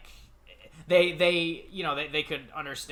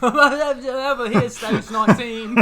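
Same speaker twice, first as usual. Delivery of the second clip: high, loud, slow mangled British; British accent collapsing into laughter; botched British accent; 11 semitones higher, 10 dB louder, much slower than usual. Retold or imitated. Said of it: "so rocky and so embarrassing".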